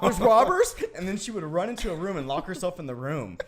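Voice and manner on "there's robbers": put-on high, loud frightened little boy; scared kid. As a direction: squeak it out like a terrified five-year-old. Kid frightened by sister's prank.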